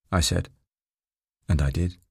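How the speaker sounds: usual speaking voice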